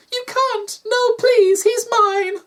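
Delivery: very high pleading creature; alarmed floof. A narrator's reading. Floof begging doctor not to take him, alarmed. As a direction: beg in a tiny squeaky voice.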